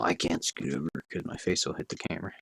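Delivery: ordinary delivery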